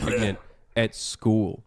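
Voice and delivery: low, slow, soft mock disgust; mocking imitation. Mimicking opponents appalled by a pregnant teacher, slow mocking voice. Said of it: "That's what you sound like".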